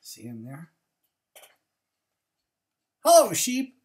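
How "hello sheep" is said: high, loud cheery duck greeting. A narrator's reading. Duck greeting sheep, high and loud. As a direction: call out brightly as Duck. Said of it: "said Duck".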